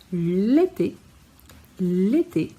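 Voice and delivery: l'été with both é sounds crisp and closed; teacher modelling l'été for learners; clear French été. Shown as a demonstration. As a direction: say l'été slowly, each é sharply closed. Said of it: "o E tem que ser bem pronunciado".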